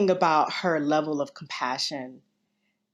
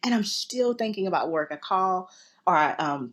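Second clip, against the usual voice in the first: ordinary talk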